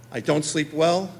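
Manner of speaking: ordinary talk, nothing marked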